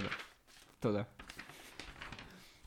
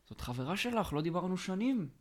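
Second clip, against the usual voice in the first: raised, lightly feminine voice; woman's voice; 7 semitones higher than usual. Retold or imitated. Prank caller faking a woman's voice to a stranger. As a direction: put on a woman's voice for the prank call. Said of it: "בקול של אישה".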